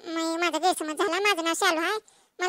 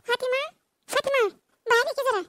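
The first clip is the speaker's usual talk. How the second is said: calling out; 6 semitones higher than usual. Acted. Calling neighbour out of house.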